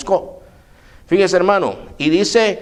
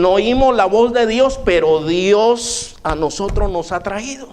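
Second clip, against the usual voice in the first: ordinary talk, nothing marked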